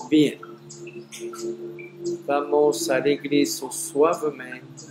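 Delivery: voz suave y pausada de meditación; guía el regreso con calma; meditación guiada. Acted. Closes the guided meditation. (voz serena de meditación)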